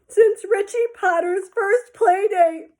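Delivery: high, loud, slow through sobs; tearful confession. Acted. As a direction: force the words out between sobs. Child confessing while sobbing.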